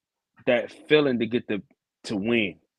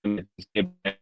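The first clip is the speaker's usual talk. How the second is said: choppy bad connection audio; cutting out over a bad line; breaking up. Real, not acted. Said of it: "You're breaking up a bit".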